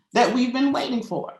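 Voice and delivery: emotional laugh. Real, not acted. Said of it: "I'm feeling emotional".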